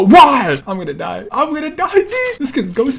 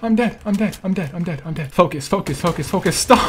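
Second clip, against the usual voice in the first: ordinary talk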